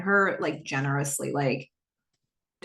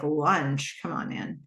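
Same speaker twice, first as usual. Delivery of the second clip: mild scoffing disbelief; 4 semitones lower, 4 dB quieter, slower than usual. Retold or imitated. Haley's inner scoff.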